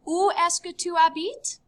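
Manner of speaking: "Où est-ce que tu habites" in one run; high voice, rising on "habites"; connected question. Shown as a demonstration. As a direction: ask it fluently, without a pause after "Où". Teacher then asks it as one question.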